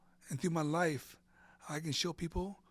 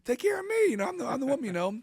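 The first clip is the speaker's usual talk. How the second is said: high mock demand; 10 semitones higher, 9 dB louder, faster than usual. Joking about being cared for.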